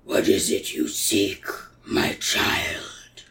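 eerie whispered monster voice. Acted. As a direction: breathe it out like an ancient beast. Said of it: "and he goes".

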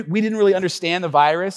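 usual speaking voice

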